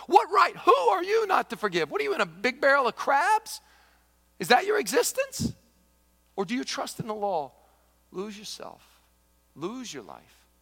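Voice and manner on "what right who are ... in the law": much higher, louder, pressing questions; preaching climax. Acted. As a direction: hammer the questions at the congregation.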